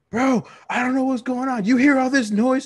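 high, loud frantic voice; frantic friend. Retold or imitated. Friend faking emergency call, frantic high voice. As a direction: play the panicked buddy on the phone.

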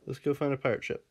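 usual speaking voice

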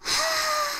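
very soft breathy yawn. A narrator's reading. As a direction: yawn softly, sleepily. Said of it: "I yawned again".

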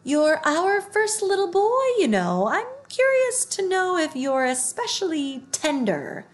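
put-on a greedy tiger licking its lips over a little boy; hungry tiger. A narrator's reading. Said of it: "The tigers went on talking in turn".

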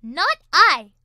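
loud careless refusal; dismissive duck. A narrator's reading. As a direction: toss off a loud no. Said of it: "said the duck".

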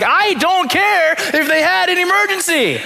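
high, laughing mock defiance; defiant retort. Pastor retorts to his wife in the car, laughing mock defiance. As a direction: brush off your wife's excuse, half-laughing. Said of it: "And I was like".

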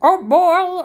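ordinary delivery

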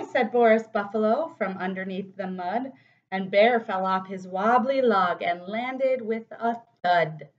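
put-on lively read-aloud for kids; animated picture-book narration; storybook reading. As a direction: read it like storytime for little ones. Reads picture book to children.

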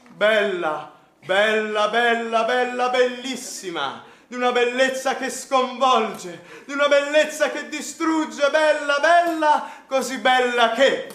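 loud, slow poetic declaiming; italian poetry declamation. Acted. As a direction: recite it like a grand romantic poet. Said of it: "fatti recitare la poesia".

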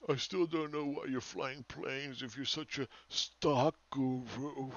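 slow, sneering mimic of a smug commenter; mocking the doofus. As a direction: drawl it out like a know-it-all. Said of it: "Then you got this doofus over here".

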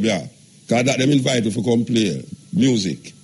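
normal talking voice

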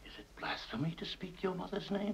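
a cold, menacing whisper; harsh whisper. Acted. Father whispering a menacing challenge to his son. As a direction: hiss it right at his face.

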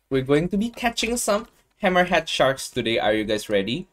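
usual speaking voice

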